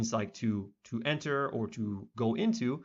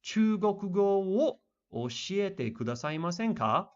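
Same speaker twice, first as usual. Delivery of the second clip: higher, slow careful reading of the Japanese; example sentence read slowly; 8 semitones higher, slower than usual. Shown as a demonstration. Teacher reading an example sentence to students, slow and high. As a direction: read it out like a textbook model line. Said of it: "this first example says".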